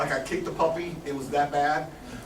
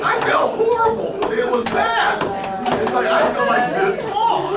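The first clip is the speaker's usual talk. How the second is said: high, slow words bent by laughter; rueful laughing; 13 semitones higher, 5 dB louder, slower than usual. Real, not acted. Guest laughing about feeling guilty over the bank manager. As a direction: laugh while owning the guilt. Laughing while saying he felt bad.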